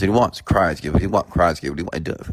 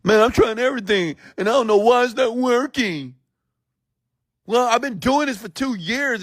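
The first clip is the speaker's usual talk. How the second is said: put-on much higher, crying-child whine; whining adult. Speaker imitating a forty-year-old whining about no money, childlike. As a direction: play a grown adult throwing a tantrum. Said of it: "imagine a forty-year-old man".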